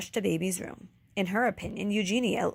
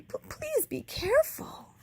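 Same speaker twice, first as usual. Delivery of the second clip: timid. A narrator's reading. Timid Baby pleading.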